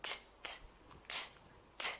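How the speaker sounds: unvoiced "t" ticked out softly; soft "t" clicks, no voice; whispered t sound. Shown as a demonstration. Teacher softly clicking t like watching tennis. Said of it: "making the sound t".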